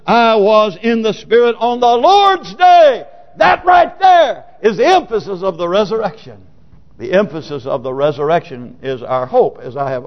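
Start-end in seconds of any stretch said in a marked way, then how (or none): 0.1-3.1 slow, high, shouted scripture; emphatic declaiming. Acted. Preacher stressing the resurrection day, emphatic shout. Verse repeated as emphatic climax. 3.4-6.5 forceful shout that eases off; emphatic preaching. Real, not acted. Preacher pointing congregation to the verse, emphatic shout.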